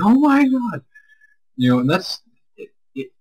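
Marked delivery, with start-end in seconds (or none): 0.0-0.8 high shocked yelp; shocked shriek. Retold or imitated. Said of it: "He was like".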